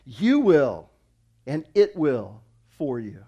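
YOU WILL punched loud, then a pause; emphatic. Retold or imitated. Preacher quoting Jesus on faith without doubt, emphatic. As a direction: hammer "you will", then land "it will for you" in beats. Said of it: "Jesus uses very emphatic language here".

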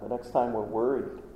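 normal talking voice

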